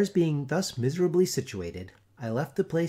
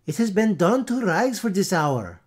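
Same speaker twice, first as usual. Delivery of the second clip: put-on much higher, loud Dutch-accented shriek; screaming wives; 6 semitones higher, 7 dB louder than usual. A narrator's reading. Wives screaming over the kraut, high Dutch accent.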